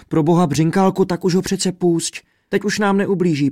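high, loud pleading; pleading princess. A narrator's reading. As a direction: beg him to let father go. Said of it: "prosila Světluška". (vysoké, naléhavé prošení)

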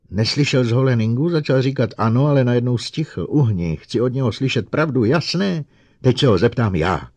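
loud, high, insistent; impatient, forceful quarrelling; heated argument. A narrator's reading. Heated quarrel over the robot.

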